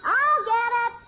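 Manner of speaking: loud, high and slow, grabbing for the phone; eager shout. Acted. Sister racing her brother to the ringing phone, loud eager shout.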